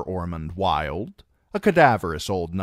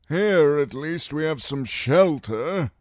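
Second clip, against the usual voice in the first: put-on an old knight insisting, higher and louder; 10 semitones higher, 6 dB louder than usual. A narrator's reading. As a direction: hold the line on staying. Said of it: "Wild was insisting".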